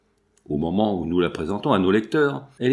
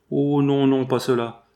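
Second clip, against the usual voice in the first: higher, impetuous refusal; 4 semitones higher, 3 dB louder than usual. A narrator's reading. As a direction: cut in with a headstrong protest. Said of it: "fit impétueusement Marie". (refus impétueux)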